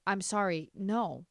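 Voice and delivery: usual speaking voice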